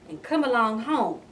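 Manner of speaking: usual speaking voice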